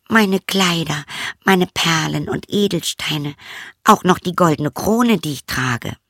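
put-on high, light girlish voice, a little dismissive; a young princess shrugging at the frog. Princess brushing off the frog, high girlish voice.

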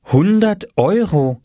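high, slow, astonished mother; drawn-out, impressed reading of a sign; amazed reading aloud. A narrator's reading. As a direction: read it out slowly in wonder. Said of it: "las Mama auf dem kleinen Papierschild, ... und staunte".

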